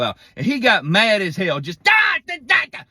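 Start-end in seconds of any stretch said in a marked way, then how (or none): 1.8-2.8 high, loud frustrated wailing; mock screaming fit of a child whose taco fell apart; toddler tantrum scream. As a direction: wail like a furious little kid. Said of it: "just started screaming".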